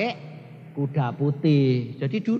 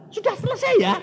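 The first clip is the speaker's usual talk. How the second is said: put-on high, gentle old woman asking; old mother's voice; 5 dB louder, faster than usual. Mother asking if the visit is finished, high. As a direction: ask it lightly, high.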